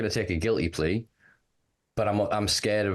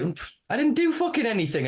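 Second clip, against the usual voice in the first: incredulous protest. Retold or imitated. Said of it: "And you're like".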